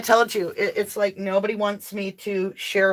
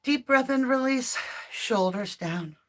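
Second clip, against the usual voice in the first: calming guidance; 6 dB quieter than usual. Acted. Guiding breath before prayer.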